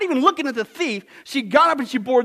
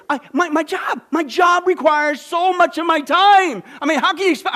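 louder, higher, slowed protest of the excuse-maker; mock defensive shout; 5 semitones higher, 6 dB louder than usual. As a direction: fling the excuse out indignantly. Acted excuse, defensive outburst.

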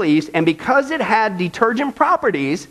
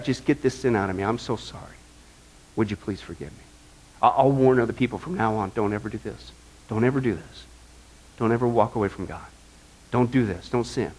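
much lower, much softer, broken; contrite plea; 10 semitones lower, 7 dB quieter than usual. Retold or imitated. David quietly begging forgiveness, hushed and broken. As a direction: plead in a hushed, repentant voice. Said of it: "I mean it, God".

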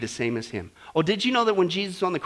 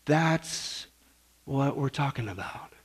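ordinary talk

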